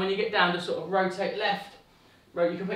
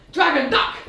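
higher, louder call to duck; called game cue; 7 semitones higher, 5 dB louder than usual. Acted. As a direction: call it out like the dragon is overhead.

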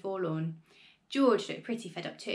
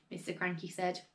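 ordinary delivery

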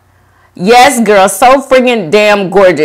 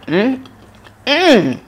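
ordinary talk, nothing marked

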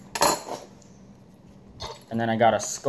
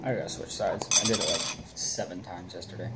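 talking with dip in lip; chewing tobacco; 3 semitones lower, 8 dB quieter than usual. Real, not acted. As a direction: speak around a lip of dip. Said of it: "we're gonna pack the lip".

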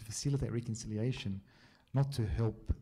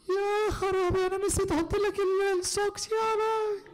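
high, fast, panicked mum in Arabic; frantic mum voice, high and fast; flustered mum; 7 dB louder, much faster than usual. Retold or imitated. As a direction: squeal it out like a frantic Egyptian mother. Mum panicking about forgotten socks.

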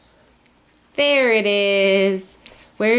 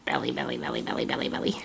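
whispering; 9 dB quieter, much faster than usual. Shown as a demonstration.

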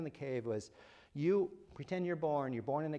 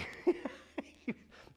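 laugh; 12 semitones higher than usual. Real, not acted. Laughing at own joke.

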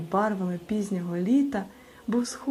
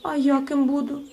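higher, louder little voice asking; don't want to be left out; eager; 8 semitones higher, 4 dB louder than usual. A narrator's reading. Hropsia asks for a role, eager high voice. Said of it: "не хотіла залишатися осторонь Хропся".

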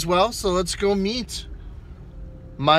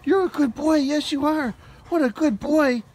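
much higher, cooing puppy voice; 8 semitones higher than usual. Real, not acted. Greeting the dog again.

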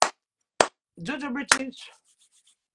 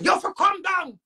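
higher, louder commanding yell; a shouted order in a retold quarrel; 7 semitones higher, 4 dB louder than usual. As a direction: call it down at them. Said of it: "I say".

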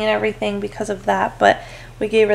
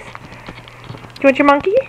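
put-on baby talk; 4 semitones higher, slower than usual. Mom offering baby toy.

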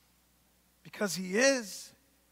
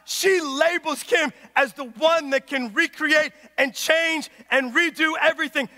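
ordinary talk

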